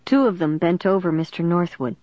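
ordinary delivery

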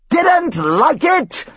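hammed-up furious scream. A narrator's reading. Father outraged at his son, screaming. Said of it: "his father screamed".

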